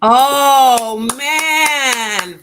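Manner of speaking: a sudden loud, high "oh man", struck by the story; moved exclamation. Real, not acted. Host reacting to the mom story, loud and moved. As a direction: let it burst out of you, genuinely touched.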